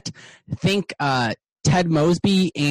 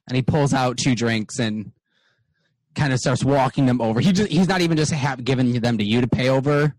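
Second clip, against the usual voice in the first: ordinary talk, nothing marked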